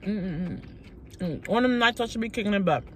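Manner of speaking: ordinary talk, nothing marked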